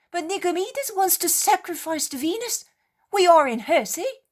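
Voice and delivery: high, loud anxious plea; frightened pleading. Acted. Sailor insisting to Peter, loud and fearful.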